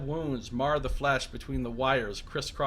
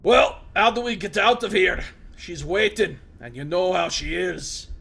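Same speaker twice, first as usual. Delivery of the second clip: loud, raised voice of a wounded prisoner; gruff Mazov; 5 semitones higher, 9 dB louder than usual. Acted. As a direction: bark it at the intruder in surprise.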